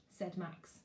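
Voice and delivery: ordinary delivery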